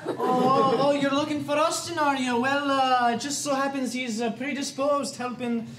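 hammed-up Scottish accent; put-on Scotsman bluffing on the phone; comic Scottish voice, stalling as he improvises; Argyle McCulloch. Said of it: "Argyle McCulloch might have put a big fat Scottish".

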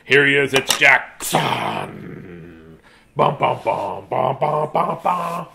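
very slow, drawn-out intro then hummed fanfare; lightly voiced ring announcer; mock announcer fanfare. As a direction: build the entrance slowly.

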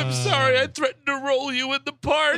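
mock tearful apology. Mocking the tearful movie reunion.

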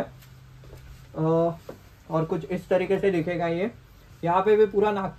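muffled behind helmet chin guard; speaking inside full-face helmet. Real, not acted. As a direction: mouth covered by cloth balaclava. Seen 3: Full-face helmet over balaclava covering mouth throughout; refs bare-faced.

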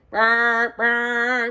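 imitating a horn for the kids; horn sound. Shown as a demonstration. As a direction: blow it like a horn. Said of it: "Blow your horn".

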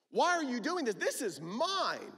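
high, loud why, then a slow possessive this is mine; indignant outburst. Acted. Older brother to his father, loud resentful protest. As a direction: claim it all as yours, resentful. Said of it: "He's upset".

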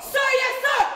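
loud recruit reply; shouting. Acted. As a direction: bark it back at the sergeant.

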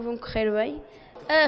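ordinary delivery

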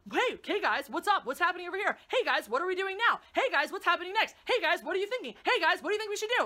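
mock someone who asks about everything; mocking Han impression. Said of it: "what comes out of Han's mouth is a question".

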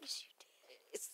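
barely audible "Yes, you did"; near-silent teasing insistence; almost under the breath to her grandson; very quiet. Real, not acted. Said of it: "[name], you can talk louder".

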